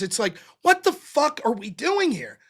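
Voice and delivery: ordinary talk, nothing marked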